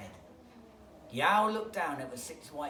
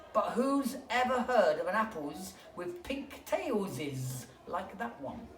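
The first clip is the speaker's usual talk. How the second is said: snake voice; 6 semitones higher, 6 dB louder, slower than usual. Acted.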